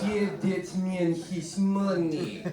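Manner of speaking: put-on loud, slow, thick Russian accent, high-pitched; John Malkovich. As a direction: ham up a big drawn-out Russian accent. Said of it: "with, uh, John Malkovich".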